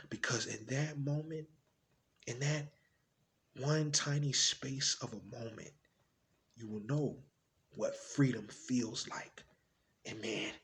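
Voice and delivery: soft, low, lingering reflection; hushed wonder. Acted. Parent describing a moment of freedom, hushed. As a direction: drop down and let each phrase hang.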